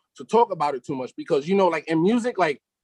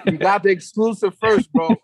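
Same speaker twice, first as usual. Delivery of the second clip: laughing while talking, louder; 3 semitones higher, 6 dB louder than usual. Real, not acted. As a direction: chuckle through the words. Laughing over the exclusive.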